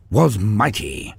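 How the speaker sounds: ordinary delivery, nothing marked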